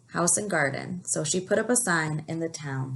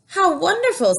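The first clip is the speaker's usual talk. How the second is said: much higher, louder delight; amazed; 12 semitones higher, 8 dB louder than usual. A narrator's reading. Big Anthony marvels at the magic pot, excited. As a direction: gasp it out in wonder. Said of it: "said Big Anthony".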